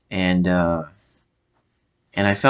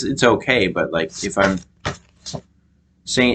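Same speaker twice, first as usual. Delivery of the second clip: ordinary delivery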